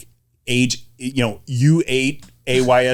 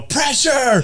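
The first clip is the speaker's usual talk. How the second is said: high-pitched mock sideline holler; imitated yell; faster than usual. Imitating mom at the game when her kid was on offense, yelling. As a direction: impersonate an overexcited parent yelling. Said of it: "she would yell".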